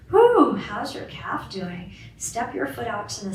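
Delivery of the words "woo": high, loud cheering woo; joyful whoop. Real, not acted. Teacher celebrates the class finishing the affirmation, loud cheering whoop. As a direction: whoop with joy.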